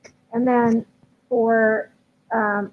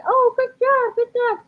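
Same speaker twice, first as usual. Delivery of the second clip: much higher, bright-pitched praise; happy praise voice; 12 semitones higher than usual. Shown as a demonstration. Trainer praising the dog once it stops, in a very high voice. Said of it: "So my whole tone changes".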